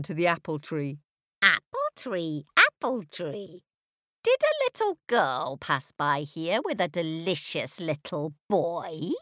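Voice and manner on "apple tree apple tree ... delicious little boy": witch voice. A narrator's reading. Witch questions the tree.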